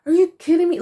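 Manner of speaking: high, loud shocked outrage; furious disbelief. Retold or imitated. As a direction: mimic her exploding in disbelief. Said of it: "she was pissed".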